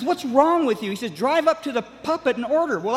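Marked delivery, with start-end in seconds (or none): 0.0-0.9 higher-pitched retold scolding; exasperated coach. Coach demands answers from his drugged student driver, exasperated. 1.1-2.8 higher-pitched retold order; exasperated coach. Coach orders confused student driver to the speaker, exasperated.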